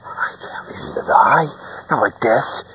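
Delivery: soft, unvoiced plea; hushed plea to a dying friend; urgent whisper. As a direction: whisper back to the dying man.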